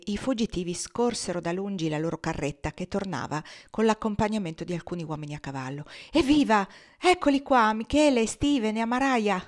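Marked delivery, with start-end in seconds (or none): 6.1-9.4 loud, high, happy cry of relief; joyful shout. A narrator's reading. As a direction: shout it out with glee at the rescue. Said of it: "gridò allegramente Finia". (grido allegro e forte)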